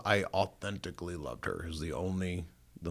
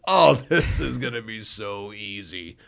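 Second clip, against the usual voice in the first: loud, higher, laughing dismissal; gleeful scoffing; 4 semitones higher, 11 dB louder than usual. Retold or imitated. Listener hearing the twangy Texan on tape, gleefully cocky. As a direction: scoff with a laugh, sure of victory. Cocky reaction to Southern preacher.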